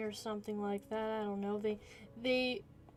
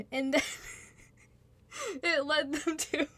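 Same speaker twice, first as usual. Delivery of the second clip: laughing; 4 semitones higher than usual. Real, not acted. Giggling at her own plot.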